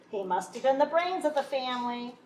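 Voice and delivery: ordinary delivery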